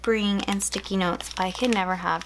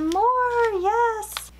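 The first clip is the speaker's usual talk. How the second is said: excited squeal; 12 semitones higher, 3 dB louder than usual. Real, not acted. Finding more washi tape.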